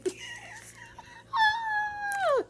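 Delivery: shrieking high laugh at her own confusion; high squealing laugh. Real, not acted. Vlogger laughs at herself over a backwards letter, squealing. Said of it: "Lord Jesus".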